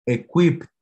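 "equipped" with final pt clearly said; two consonants at the end. Shown as a demonstration. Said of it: "necesitamos pronunciar equipped".

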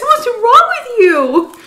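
can't believe her ears; incredulous. Retold or imitated. Scolding her husband for his joke, high and incredulous. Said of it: "I said".

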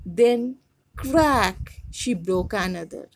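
story reading. A narrator's reading. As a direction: read the folktale out to students with expression. Teacher reads story aloud.

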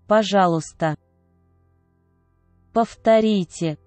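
ordinary talk

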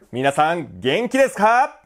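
high, rising shout to the audience; high-pitched rousing call; loud call-out. Shown as a demonstration. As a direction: holler the question with a drawn-out lift. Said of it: "だんだん大きくして".

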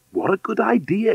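high, lively exclamation from the man; delighted cry. Acted. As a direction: exclaim it with rising pitch. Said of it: "cried the man".

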